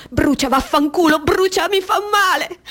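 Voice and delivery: high, agonised cry; pained outcry. Acted. Burning woman cursing because it hurts, pained outcry. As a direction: cry out in burning pain, pitch climbing. Cries out that it burns.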